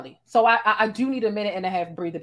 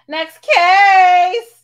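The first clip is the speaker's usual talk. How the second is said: a much higher, louder shout of the word case; 10 semitones higher, 13 dB louder than usual. Real, not acted. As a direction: yell it out to throw the caller off the show. Dismissing a caller, talk-show style.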